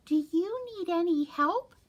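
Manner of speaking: high, squeaky little mouse. Acted. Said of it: "in her high squeaky voice".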